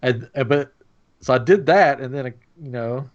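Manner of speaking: ordinary talk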